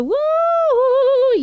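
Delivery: long, loud, high "woo"; excited cheer. Real, not acted. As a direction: whoop to welcome a friend. Cheering as a friend arrives.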